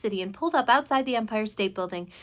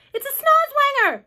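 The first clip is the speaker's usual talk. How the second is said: high, loud yell; police chief's high-pitched shout; alarmed cry; 8 dB louder than usual. A narrator's reading. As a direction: cry out the discovery in panic. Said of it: "cried the chief of police".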